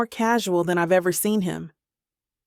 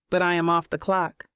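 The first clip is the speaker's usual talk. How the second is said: usual speaking voice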